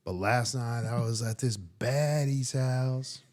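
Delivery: slow, drawn-out rapper drawl. Acted. Rapper answering questions in a deposition, slow impression. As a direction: answer lazily like an unbothered rapper witness. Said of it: "That's the one where he's like".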